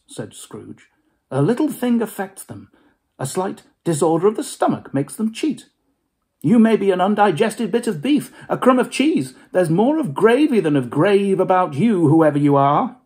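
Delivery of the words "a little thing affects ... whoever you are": put-on higher, louder scoffing old miser. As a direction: bluster to hide the fear. Scrooge arguing with ghost.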